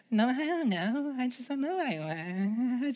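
high, soft mock whimper; hammed-up weak husband; whiny husband. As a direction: whimper like a helpless man. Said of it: "spineless husband voiced again".

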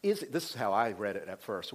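normal talking voice

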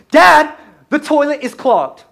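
put-on high, loud, slow child's call from the bathroom; kid calling out. Kid calling dad about toilet.

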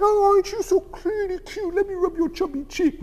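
put-on high, soft baby-talk coo; mock sweet cherub voice; cutesy angel. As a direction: coo like you're pinching a baby's cheek. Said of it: "the angel is like".

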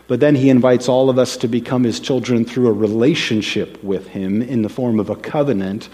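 ordinary talk

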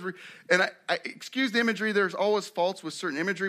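ordinary delivery

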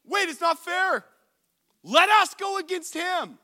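high, loud outraged plea; loud girls' protest. Retold or imitated. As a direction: cry out that it's unfair. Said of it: "all of the girls on every team were like".

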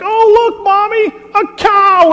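put-on very high, loud child's squeal of delight; excited grandkid. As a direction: shout it like a thrilled little kid spotting a wonder. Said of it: "they're so excited to see this cow".

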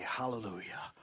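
soft whispered hallelujah, falling away. Acted. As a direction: breathe it out as a whisper. Quiet worshipful close of quote.

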